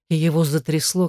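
plain reading voice

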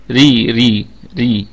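ري said with a light thin ra, repeated; thin ra demo. Shown as a demonstration. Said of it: "هتكون مرققة". (ري براء مرققة)

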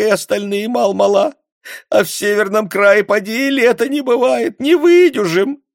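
high, loud pleading wail; tearful begging. A narrator's reading. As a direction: beg on your knees for the children. Kneeling woman begging the secretary. (мать на коленях умоляет секретаря, плача)

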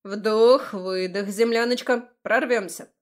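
loud coach-like orders; bossy, rousing pep talk; giving commands. A narrator's reading. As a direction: call out breathing commands to rally someone. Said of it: "он принялся раздавать команды".

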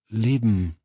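tentative single word, left hanging; unsure start. A narrator's reading. Woman starts explaining what life is, unsure. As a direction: begin a touch uncertainly. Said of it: "begann sie unsicher".

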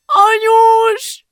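very high, loud, drawn-out shriek; hysterical scream. A narrator's reading. Girl sees her mother dying, hysterical scream. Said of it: "Julcsi hisztérikusan zokogni kezdett".

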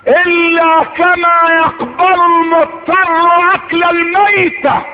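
high, ringing declamation; high-pitched oratorical quote; impassioned preaching. Acted. First take of a repeated quote.

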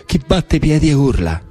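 ordinary talk

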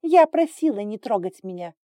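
high, loud protest; angry rebuke. A narrator's reading. Acted quarrel, she rebukes him. (сердито, громко и высоко)

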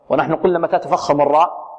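ordinary delivery

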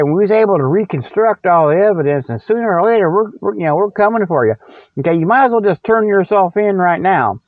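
ordinary talk, nothing marked

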